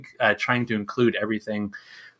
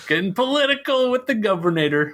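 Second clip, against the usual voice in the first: high-pitched mock announcer call; 10 semitones higher than usual. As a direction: sing out the title in a high put-on voice. Mock segment title, jingle-like call.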